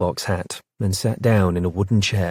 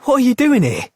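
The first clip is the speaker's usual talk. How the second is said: high, loud amazed question; surprised; 6 dB louder than usual. Acted. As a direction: ask it in happy disbelief. Surprised at meeting friend.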